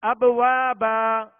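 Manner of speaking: "ابوابا" chanted slow and loud, final aa held; slow recitation of "ابوابا", ending on open -baa; alif stop. Shown as a demonstration. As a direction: stretch the long vowels of "ابوابا". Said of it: "تنوین کو الف سے بدل کر".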